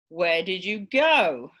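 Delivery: "go" punched loud with a falling pitch; stress on GO. Shown as a demonstration. Teacher showing sentence stress, stress on "go". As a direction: lean hard on the last word, "go". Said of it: "for example, I can say".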